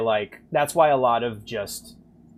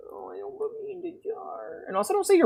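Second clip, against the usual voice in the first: much higher, squeaky little-voice plea. Pretend. Streamer begging chat not to jar him, squeaky plea. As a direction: beg like a tiny trapped critter.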